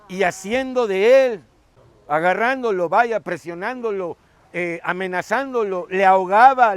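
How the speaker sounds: loud, driving sermon delivery; loud impassioned retelling of the parable; forceful preaching. Acted.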